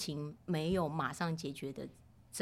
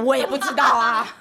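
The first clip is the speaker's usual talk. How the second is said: loud laughing shrug; laughing reply; 5 semitones higher, 14 dB louder than usual. Retold or imitated. Mother answering her son, loud and laughing. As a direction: laugh it off lightly. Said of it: "我说".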